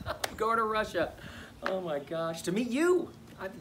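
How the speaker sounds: high, laughing gush; giddy excitement. Acted. Man about to meet his bride-to-be, giddy. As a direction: bubble over, rising to a squeal.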